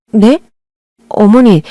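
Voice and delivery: usual speaking voice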